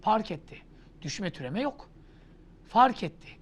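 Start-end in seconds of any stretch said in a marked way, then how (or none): none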